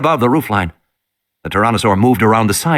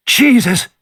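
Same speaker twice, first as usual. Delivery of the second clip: frightened gasp of a word; 11 semitones higher than usual. Acted. Ed sees the Tyrannosaur.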